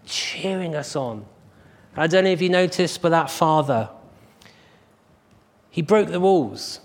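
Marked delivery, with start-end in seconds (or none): none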